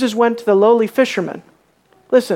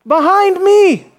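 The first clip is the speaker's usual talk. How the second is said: high loud slow call across the shore; shouted call of Jesus to fishermen; shouting; 8 semitones higher, 4 dB louder, slower than usual. Acted. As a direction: rabbi calling out along the sea.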